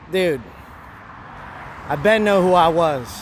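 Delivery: normal talking voice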